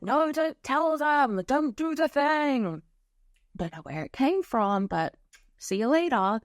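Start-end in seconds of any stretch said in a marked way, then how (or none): 0.0-2.8 high, slow, drawn-out pleading; mock protesting voice. Dense energy resisting the podcast recording, mock protest. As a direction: put on a high voice and stretch the protest.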